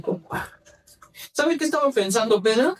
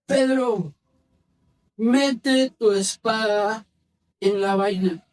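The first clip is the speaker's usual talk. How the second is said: slow, spaced-out command; deliberate, drawn-out reading; measured scripture reading; much slower than usual. Acted. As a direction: read it slowly, pausing after each phrase. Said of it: "Dice que Jesús le dijo a Pedro".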